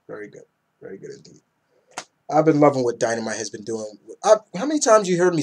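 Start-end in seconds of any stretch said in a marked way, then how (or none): 0.1-0.4 much softer echo. Real, not acted. Repeats fading to whisper. 0.8-1.4 soft whispered echo, no voice. Real, not acted. Vlogger whispering his praise to viewers, barely audible.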